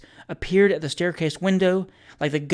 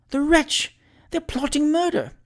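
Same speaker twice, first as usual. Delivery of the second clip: very high, scandalised woman's voice; shocked, squeaky outrage; horrified; 12 semitones higher, slower than usual. A narrator's reading. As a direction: exclaim in high-pitched horror. Horrified landlady overhearing a plot.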